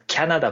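"キャナダ" opening on kya instead of ka; English-style pronunciation. Shown as a demonstration. Explaining how Canada sounds in English, English-style pronunciation. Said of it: "英語的になんかキャナダっぽい感じ".

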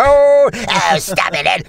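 mock squabble starting high, then dropping; imitated bickering character. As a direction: cry out high, then drop for the retort. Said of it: "he's just like, papers are flying".

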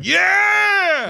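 long, high, loud whoop; rousing cheer. Retold or imitated. Viewer fully on board with Phoebe, cheering loudly. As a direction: yell it out like a won-over fan.

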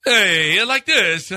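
hammed-up Italian greeting; Italian accent. Shown as a demonstration. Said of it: "what's the stereotypical way that an Italian talks".

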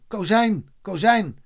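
high-pitched call, repeated; contained shout to get someone's attention; shouting a name. A narrator's reading. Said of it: "zette zijn hand voor de mond en schreeuwde".

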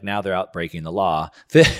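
ordinary talk

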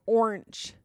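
Orange with a stretched, odd vowel; funny orange. Real, not acted. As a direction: say Orange in her own odd way. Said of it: "sounds like you're talking about a, a sea creature".

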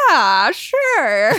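mock drawn-out, half-hearted yes; reluctant agreement. Friend answering whether she's wanted without the dog, reluctant agreement. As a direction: stretch it out as a doubtful friend would. Voicing friend's unconvinced reply.

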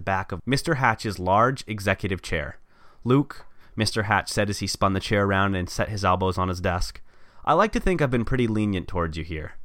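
reading a story aloud; steady storyteller read; story narration. Acted. Reads own story aloud.

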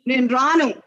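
put-on boy's high, loud refusal; acted loud yell. A narrator's reading. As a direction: yell it out like a stubborn child. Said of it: "భూమి బద్దలు పోయినంత గట్టిగా అరిచాడు".

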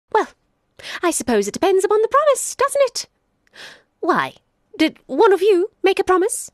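sly jackal. Acted. Jackal pretending to leave the lion and boy, high and chirpy. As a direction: play innocent and fluttery.

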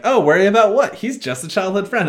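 put-on high, bright teasing girl voice; light high-pitched friendly jab; Tifa voice. Tifa voiced in retold scene.

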